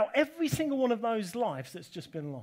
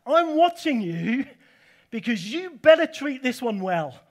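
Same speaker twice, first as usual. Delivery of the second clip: stern warning as God; 4 semitones higher, 9 dB louder than usual. Retold or imitated. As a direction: lean in like a watchful father laying down the law. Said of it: "He says".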